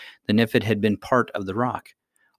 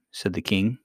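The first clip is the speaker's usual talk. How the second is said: ordinary delivery, nothing marked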